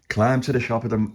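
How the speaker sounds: plain reading voice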